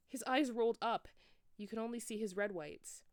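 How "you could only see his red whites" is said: usual speaking voice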